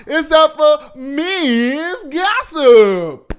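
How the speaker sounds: high, loud, stretched-out announcement; segment call-out. Pretend. Host announces gossip time to listeners, loud drawn-out call-out. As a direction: sing out the segment name, long and high.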